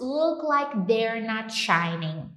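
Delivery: slow, careful English line; slow model reading. Shown as a demonstration. Teacher reading an English line slowly to students. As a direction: read it slowly for the class. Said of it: "English lesson model line".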